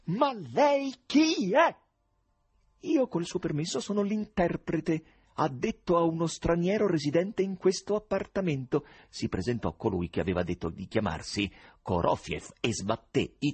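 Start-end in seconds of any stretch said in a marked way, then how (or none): 0.0-1.7 high, loud, drawn-out demand; infuriated shout. A narrator's reading. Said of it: "Completamente infuriato ... e gridò". 2.8-8.8 high tenor, courteous self-introduction; Korofiev. A narrator's reading. Stranger grandly introduces himself as interpreter.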